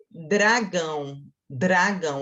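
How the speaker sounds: "dragão" drawn out, DR together; slow demo word. Shown as a demonstration. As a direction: stretch "dragão" slowly. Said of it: "ó, dre, de, ra". ("dragão" bem devagar)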